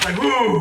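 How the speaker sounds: high, loud-ish "woo"; mock relieved whoop. Co-host teasing the exhausted ranter, mock relieved whoop. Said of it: "a bad experience in the bathroom".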